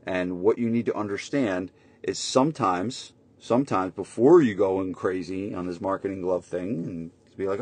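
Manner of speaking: ordinary delivery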